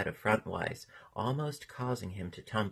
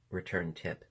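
ordinary delivery

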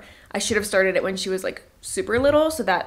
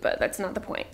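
ordinary talk, nothing marked

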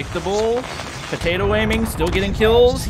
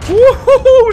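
very high, loud victory shriek; shrieking jackpot winner; 14 dB louder than usual. Real, not acted. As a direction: shriek like you hit the jackpot. Shrieks after a kill.

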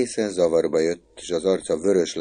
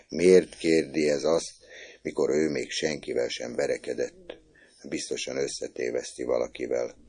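usual speaking voice

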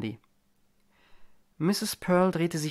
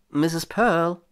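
warning; 4 semitones higher, 5 dB louder than usual. A narrator's reading. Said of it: "mahnte Landy".

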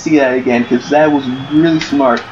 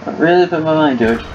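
ordinary delivery

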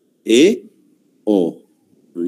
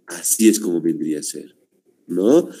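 usual speaking voice

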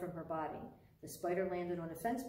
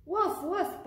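loud, high storybook woofs; dog bark; 11 semitones higher, 8 dB louder than usual. A narrator's reading. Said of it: "barked the dog".

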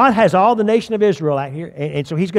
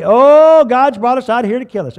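higher, louder mock whine at the start; Israelites complaining; 6 semitones higher, 6 dB louder than usual. Preacher mocking the people's fearful complaint with a high opening whine. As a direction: lightly mimic the grumbling crowd. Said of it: "they've been saying this every step of the way".

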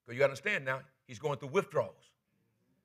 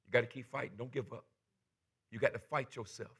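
gentle; 4 semitones lower, 7 dB quieter than usual. Retold or imitated. Kind man comforting Bobby.

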